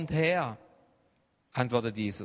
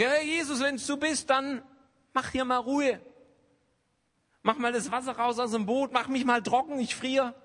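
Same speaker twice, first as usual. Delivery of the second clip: high, pleading complaint to Jesus; whiny Peter; 10 semitones higher than usual. Pretend. Peter in the boat begs Jesus, high and whiny. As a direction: beg Jesus like a needy Peter. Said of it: "wie ein Petrus sagt".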